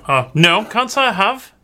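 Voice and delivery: high-pitched woman character, admitting ignorance; pondering dockside woman. Acted. Dock woman asked about beardless dwarves, high character voice.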